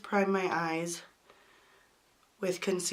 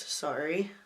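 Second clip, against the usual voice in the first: ordinary talk, nothing marked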